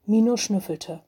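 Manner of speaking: usual speaking voice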